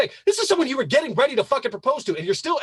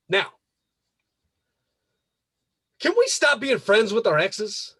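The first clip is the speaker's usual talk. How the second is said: ordinary delivery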